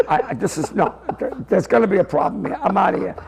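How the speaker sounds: low, soft, halting worry; muttering to himself. Retold or imitated. Teller pacing outside the box, muttering low to himself. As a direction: mutter it under your breath as you pace.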